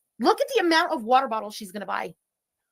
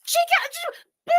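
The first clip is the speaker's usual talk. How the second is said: laughs out in a voice jumping much higher; squealing laugh. Real, not acted. Amused by the woman's haul, high squealing laugh. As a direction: let a squeaky laugh burst through the words.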